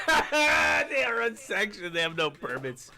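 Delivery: put-on high, slow, drawn-out valley girl parody; mock valley girl. Mocking the woman in the clip with a high drawn-out voice. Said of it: "vocal troll".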